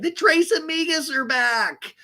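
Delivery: high, drawn-out squeal; hammed-up reunion cheer, stretched slow; mock cheer. Friends hug and announce they are back, mock cheering.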